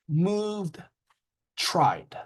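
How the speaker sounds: "moved", "tried" separately, ending in D; D-ending demo. Shown as a demonstration. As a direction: model the voiced D closing "moved" and "tried". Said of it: "there are three sounds".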